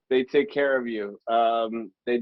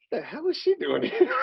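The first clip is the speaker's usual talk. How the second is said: puzzled surprise; 12 semitones higher than usual. Retold or imitated. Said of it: "I'm like".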